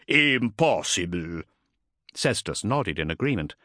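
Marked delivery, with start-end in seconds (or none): 0.1-1.4 slow, dismissive scoff. A narrator's reading. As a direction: scoff it out slowly. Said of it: "scoffed Bringar".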